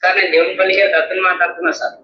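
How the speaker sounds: ordinary talk, nothing marked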